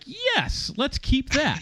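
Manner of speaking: high, gleeful yes, imitating the director; director's delighted approval. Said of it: "he was just like".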